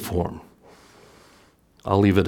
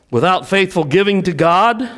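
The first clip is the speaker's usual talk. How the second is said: ordinary talk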